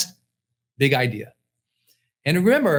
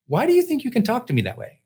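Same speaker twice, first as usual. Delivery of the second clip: higher, fast mock of his wife's indignant question; imitating wife's challenge; 7 semitones higher, faster than usual. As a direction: imitate the wife pushing back, a bit quicker. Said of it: "she goes".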